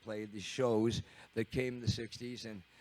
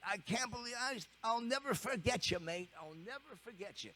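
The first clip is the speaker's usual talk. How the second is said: high, softening British farewell; English drummer fading off; 8 semitones higher, 7 dB quieter than usual. Retold or imitated. Keith walking away thanking the kid, softer high English voice.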